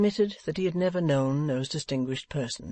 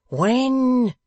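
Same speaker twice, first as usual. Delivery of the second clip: much higher and louder blunt When; 10 semitones higher, 8 dB louder than usual. A narrator's reading. Venus pressing Wegg for the date, blunt. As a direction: fire it off bluntly. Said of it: "said Venus bluntly".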